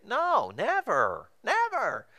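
very high, drawn-out sarcastic denial; sarcastic high-pitched reassurance; mock denial. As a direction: deny it in an exaggerated squeal. Mock reassurance to would-be pastor.

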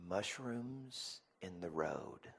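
put-on slow, quiet child's reply; son's answer. Son answering dad what angers him, slow and quiet. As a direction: give it slowly and softly, imitating a young son.

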